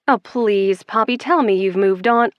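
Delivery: ordinary delivery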